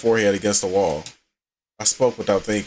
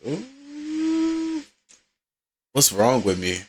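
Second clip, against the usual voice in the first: lightly voiced troubled Yuri. Yuri regretting her words, mildly troubled voice. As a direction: play her mildly self-reproachful, pitched a little down.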